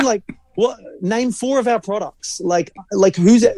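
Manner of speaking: high-pitched mock challenge; incredulous retort. Mock retort to agency planner.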